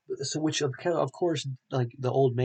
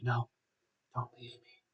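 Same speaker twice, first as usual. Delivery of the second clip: put-on faint, much softer, fading voice of a dying kid; weak dying plea; 5 dB quieter than usual. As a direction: beg him to stay with your last breath. Dying kid begging RoboCop.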